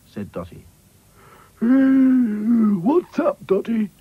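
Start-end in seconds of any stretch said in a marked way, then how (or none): 1.6-3.9 high, drowsy doll voice, a touch sleepy; just woken, mildly sleepy; sleepily. Acted. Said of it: "said Back to Front sleepily".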